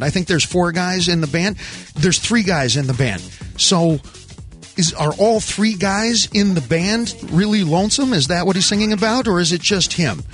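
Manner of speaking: ordinary talk, nothing marked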